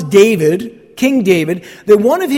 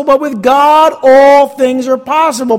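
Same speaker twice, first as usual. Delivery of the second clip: louder, ringing declaration; emphatic proclamation; 4 semitones higher, 5 dB louder than usual. Real, not acted. Preacher declaring God's power to the congregation, loud and ringing. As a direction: proclaim it with full conviction.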